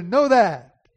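loud, high preaching voice. Acted. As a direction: preach it out with full force. Preaching at full force.

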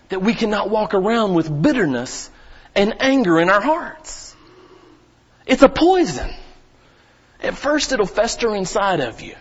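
rising, loud pulpit preaching; fiery preaching. Acted. Pastor warning the congregation about bitterness, fiery preaching. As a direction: build to a fiery pulpit climax.

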